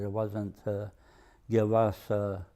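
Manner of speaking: ordinary talk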